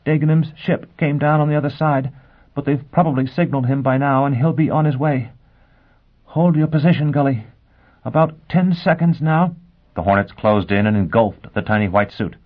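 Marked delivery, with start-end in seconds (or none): 0.1-9.6 breathless, voice still steady; out of breath, holding it steady; gasping but steady. Acted. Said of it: "Gisbella was gasping now".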